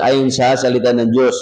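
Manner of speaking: ordinary talk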